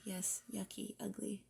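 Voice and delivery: normal talking voice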